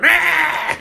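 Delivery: high, loud masked gremlin shriek; hammed-up creature screech, very high; gremlin scream. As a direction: shriek while running off in the mask. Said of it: "starts going".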